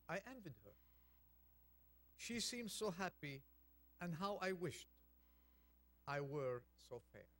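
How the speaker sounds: poem reading. Acted. Reads a poem aloud.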